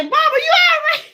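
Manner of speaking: high-pitched mock cry of alarm; worried child. Child checking on stuttering mama, mock alarm. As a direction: call out like an alarmed kid, hammed up.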